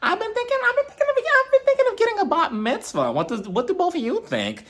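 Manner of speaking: high, sing-song mock Meredith; imitating Meredith. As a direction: squeak it up like a giddy mom announcing news. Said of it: "Meredith also announces to both of them".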